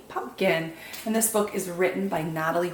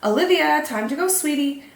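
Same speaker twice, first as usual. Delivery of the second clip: higher, louder call across the patch; 6 semitones higher, 4 dB louder than usual. A narrator's reading. Parent calls daughter Olivia to leave, calling out. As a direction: call her name from a distance. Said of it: "called a voice from nearby".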